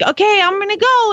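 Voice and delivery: put-on high-pitched child's announcement; imitated little boy. Mom mimicking her excited son heading outside, high child voice. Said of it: "He's like".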